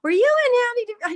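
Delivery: high, loud imitation of a friend's surprise; retold astonished question. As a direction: imitate an excited friend, pitch leaping up. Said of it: "and he goes".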